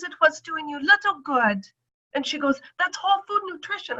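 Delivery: high knowing doctor. Retold or imitated. Doctor explaining whole food nutrition, high-pitched.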